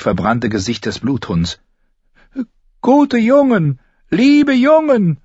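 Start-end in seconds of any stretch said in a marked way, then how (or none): 2.8-5.2 high, slow drawn-out cries; calling out. A narrator's reading. Chained prisoner coaxing his rescuers, pleading call. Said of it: "rief Jaqen Hagar".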